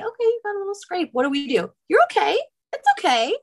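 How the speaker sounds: high, chirpy mock reassurance to a child; light, high sing-to-the-toddler comforting; coaxing. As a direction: imitate a parent coaxing a fallen child. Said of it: "we start to just coax them".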